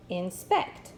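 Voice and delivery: "Inspect." with SPECT punched; light in, stressed SPECT in "Inspect."; stress on second syllable. Shown as a demonstration. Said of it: "with the accent on the second syllable".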